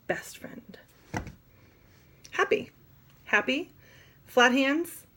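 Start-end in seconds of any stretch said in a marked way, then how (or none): 0.1-0.8 breathy, secretive whisper. Shown as a demonstration. Teacher whispering best friend to the class.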